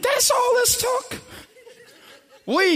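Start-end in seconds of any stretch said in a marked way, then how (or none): 0.0-1.2 shocked disbelief. Retold or imitated. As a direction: shout it in amazement. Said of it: "And I'm like".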